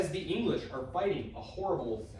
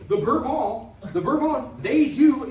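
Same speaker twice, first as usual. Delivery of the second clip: higher, louder and slow, seizing on the student's answer; emphatic correction; 10 semitones higher, 10 dB louder, slower than usual. Real, not acted. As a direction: pounce on the word like a teacher correcting.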